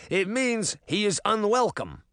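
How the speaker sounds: a touch of impatience; snapped. A narrator's reading. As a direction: snap the explanation at them. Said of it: "the first guard snapped".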